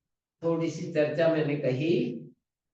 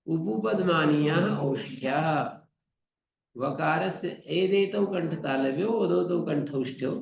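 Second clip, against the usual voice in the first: steady, measured Sanskrit sutra chant; sutra recitation; 3 semitones lower than usual. Acted. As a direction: recite the sutras like a traditional pandit. (सूत्र पाठ की शैली)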